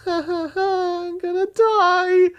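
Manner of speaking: very high, loud, drawn-out squeal; hammed-up wail. Reader after hearing there is no other bridge, squealed mock wail. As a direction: stretch it into a mock-despairing screech.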